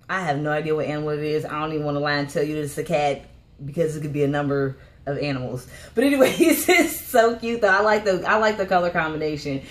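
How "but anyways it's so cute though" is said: higher, louder, laughing through the words. Real, not acted. Vlogger giggling to viewers about the cute outfit, laughing. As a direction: giggle while you gush.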